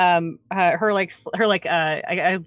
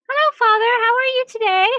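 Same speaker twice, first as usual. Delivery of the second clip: put-on much higher, louder, eager child; little girl voice; 6 dB louder than usual. Little girl begging the cleric for a trick, high and eager. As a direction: squeal it like an excited gap-toothed girl. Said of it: "she runs up to you and she goes".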